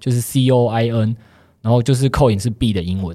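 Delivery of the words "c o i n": C O I N spelled letter by letter, slowly; spelling it out. Shown as a demonstration. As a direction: say C O I N as separate letters. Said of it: "那它怎么拼呢？".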